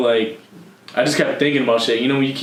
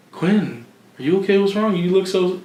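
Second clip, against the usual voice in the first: mock concerned voice; 8 semitones higher than usual. Mimicking people asking if okay.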